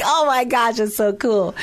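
high, loud delighted gush; excited. Retold or imitated. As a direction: squeal it with delight. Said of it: "I was like".

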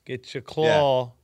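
slow, exaggerated Italian accent. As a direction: put on a thick Italian accent. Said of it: "Just like doing the Italian accent?".

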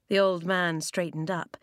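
usual speaking voice

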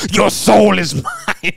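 put-on high, slow, loud spooky kid; kid doing a devil voice. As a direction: boom it out like a little demon. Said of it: "and he goes".

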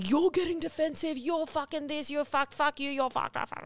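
high, heated mock swearing rant; mock angry attack. Imitating someone attacking their defensive partner. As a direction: sputter out a mock furious attack. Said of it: "No attacking".

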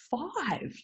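ordinary delivery

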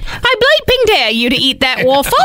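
a hint of a British accent, playful. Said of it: "It's what she would say".

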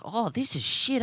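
high-pitched Kiwi soldier caricature; New Zealand accent. Acted. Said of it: "He was from Auckland".

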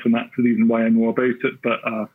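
ordinary talk, nothing marked

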